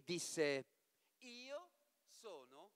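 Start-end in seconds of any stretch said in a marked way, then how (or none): 1.2-2.7 much softer, slow, airy reveal; whisper-close announcement of her name. Acted. La damigella si rivela. (rivelazione sommessa e lenta)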